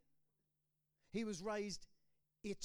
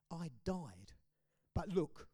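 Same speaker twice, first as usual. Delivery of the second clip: hushed; whispered. Acted. Risen Jesus speaking to John, whispered. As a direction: breathe the words out. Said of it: "and he said".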